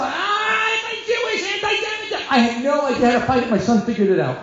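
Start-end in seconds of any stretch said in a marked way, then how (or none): 0.0-2.3 high shouted complaint at the principal; mock yelling. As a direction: yell it high and strained. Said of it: "yelling at the principal".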